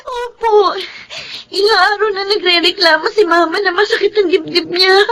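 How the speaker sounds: crying while telling the news; tearful. Acted. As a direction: speak through tears, voice catching. Daughter at hospital, crying.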